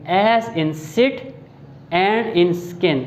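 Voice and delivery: sit and skin stretched very slowly for the short i; slow vowel demo. Shown as a demonstration. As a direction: draw out sit and skin like a teacher. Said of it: "उसको कैसे pronounce करेंगे".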